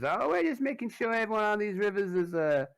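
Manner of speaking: high, loud menacing protection-racket voice; high sneering extortioner; Derek Lazenby. Acted. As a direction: lean on them with smug menace. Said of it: "Derek says".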